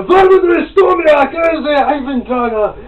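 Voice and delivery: shouted, high-pitched bad Russian accent. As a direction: ham up a terrible Russian accent. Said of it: "with my really shitty Russian accent".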